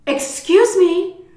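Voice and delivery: loud, high, drawn-out indignation; outraged wife act. Acted. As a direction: play the affronted wife confronting her husband. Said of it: "I was acting like his wife".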